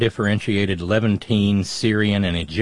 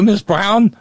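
much higher, louder teasing call; mock greeting; 11 semitones higher, 7 dB louder than usual. App message greeting her, mock high-pitched call. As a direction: sing out the name like a caller. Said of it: "there was a message that said".

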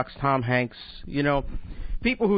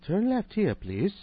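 high, prim British female sat-nav voice; mock British sat-nav giving directions; British accent; 7 semitones higher, slower than usual. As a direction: play a polite British GPS lady, pitched higher. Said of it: "female voice with a British accent".

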